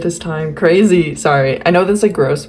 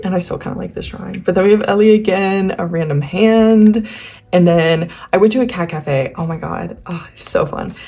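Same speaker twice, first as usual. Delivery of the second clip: ordinary delivery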